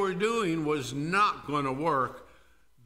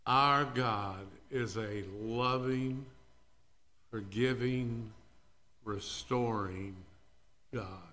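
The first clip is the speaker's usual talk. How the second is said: tender assurance; 5 semitones lower, 5 dB quieter than usual. Real, not acted. Preacher reassuring the guilty, tender. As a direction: reassure them gently.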